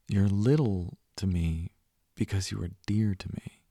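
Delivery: normal talking voice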